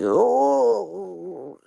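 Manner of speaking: high, crying oh like a sad dog; imitating dog's whimper. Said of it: "He was just like".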